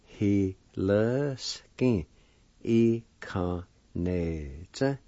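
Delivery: both parts slow and stretched out; syllable by syllable. Shown as a demonstration. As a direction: join the parts slowly, holding each syllable. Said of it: "And together".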